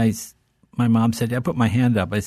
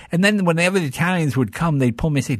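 usual speaking voice

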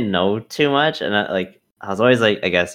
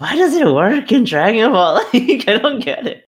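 laughing; 13 semitones higher, 4 dB louder than usual. Real, not acted. Laughing disbelief at Dragon Ball names.

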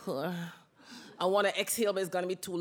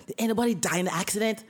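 boss's coaching line; 3 dB louder, faster than usual. Retold or imitated. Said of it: "Listen to me carefully".

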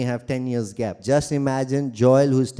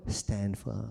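soft, much quieter firm command; hushed exhortation; 7 semitones lower, 14 dB quieter than usual. Acted. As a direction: press it home softly.

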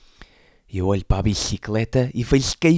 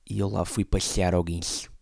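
ordinary delivery

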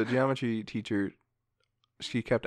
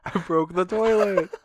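put-on sheepish kid voice; 8 semitones higher, 7 dB louder, slower than usual. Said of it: "it's like".

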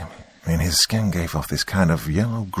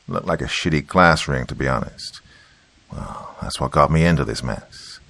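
plain reading voice

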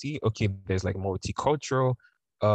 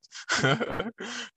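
bright high burst of laughter; amused high laugh. Real, not acted. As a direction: laugh at the memory. Laughing at memory.

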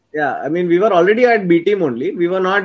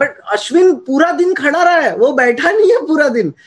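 indignant; 7 semitones higher, much faster than usual. Real, not acted. Indignant rant about player award.

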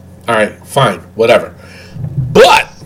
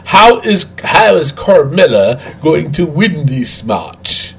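slow, over-enunciated mock English accent; mock proper English; 3 semitones lower, slower than usual. Shown as a demonstration. Rephrasing slang question in proper English for co-hosts, mock English accent. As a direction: put on a stilted, Count Chocula-like English accent. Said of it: "That sounds like Count Chocula".

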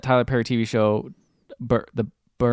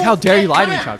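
raised, louder mock-outraged telling-off; imitated angry scolding; 7 semitones higher, 6 dB louder, faster than usual. Retelling the parent confronting a child who lied, imitated angry scolding. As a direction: act out an indignant parent's reprimand.

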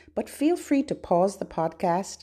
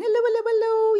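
high, rapid-fire hellos; quick, high-pitched greeting; as fast as possible; 12 semitones higher, much faster than usual. Shown as a demonstration. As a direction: rattle them off without a gap. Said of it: "as fast as we can".

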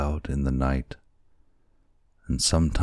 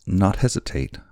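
higher-pitched, mild exclamation; crying out; 6 semitones higher, 3 dB louder than usual. A narrator's reading. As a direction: protest with a lifted voice. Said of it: "cried Kekua".

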